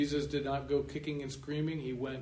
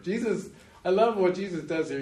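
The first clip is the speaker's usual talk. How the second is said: ordinary talk, nothing marked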